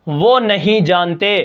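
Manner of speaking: ordinary talk, nothing marked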